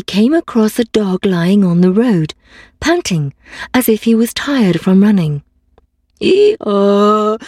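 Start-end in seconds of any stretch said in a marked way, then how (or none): 6.2-7.4 braying "ee-haw" like a donkey. Acted. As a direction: bray it out. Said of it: "said the donkey".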